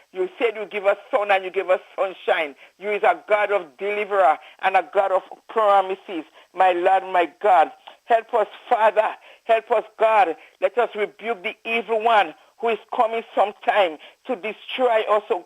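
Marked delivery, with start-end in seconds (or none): none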